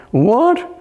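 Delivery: a sharp high What; startled exclaim; surprised. Retold or imitated. Friends startled by Erich's claim, surprised high what. Everyone freaking out at news.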